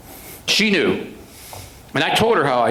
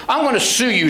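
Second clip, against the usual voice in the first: higher, pushy lawyer voice; indignant attorney; 9 semitones higher than usual. Retold or imitated. Defense attorney threatening the sergeant, higher and indignant. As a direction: scold him like a smug defense lawyer.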